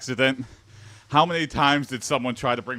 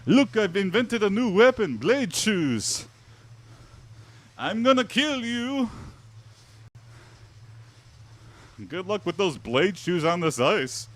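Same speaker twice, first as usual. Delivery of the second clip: high, put-on Norwegian accent; mock Scandinavian accent, high and slow; weird Norwegian accent; 9 semitones higher than usual. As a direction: play a goofy Norwegian-style caveman. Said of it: "my attempt at, I don't know, weird, uh, Norwegian".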